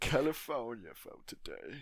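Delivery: loud, slow, drawn-out echo; mock echo. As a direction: repeat it back big and stretched. Joking echo of the song title.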